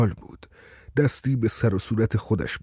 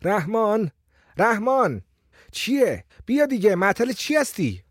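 calling out; 9 semitones higher than usual. A narrator's reading. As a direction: call across the yard. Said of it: "داد زد".

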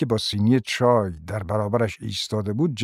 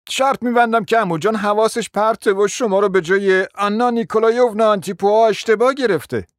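higher, louder irritated nephew; annoyed young man; 10 semitones higher, 4 dB louder than usual. A narrator's reading. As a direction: cut in impatiently. Narrator voices annoyed nephew.

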